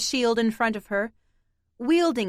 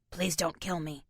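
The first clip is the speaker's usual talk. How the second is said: low soft cat voice; 5 semitones lower, 8 dB quieter than usual. A narrator's reading. Cats mimic in varied voices.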